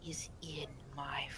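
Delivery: eerie, halting whisper; whispering stag spirit. Acted. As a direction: whisper it as an ancient forest guardian, pausing between phrases. Game master voices the stag spirit.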